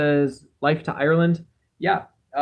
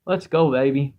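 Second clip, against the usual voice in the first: ordinary delivery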